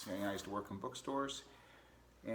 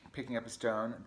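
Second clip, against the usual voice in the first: ordinary delivery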